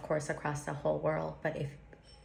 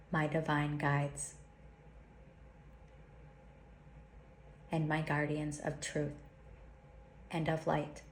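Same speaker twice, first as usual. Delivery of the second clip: slow, solemn prayer with long pauses; slow invocation; 3 dB quieter than usual. Acted. Teacher leads listeners through a protection invocation, slowly and solemnly. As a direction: intone each line like a sacred vow. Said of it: "I'll say it slowly".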